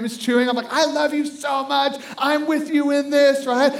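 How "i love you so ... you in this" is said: high, slow, impassioned declaration; hammed-up rallying of a child; inspiring pep talk. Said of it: "I started inspiring him".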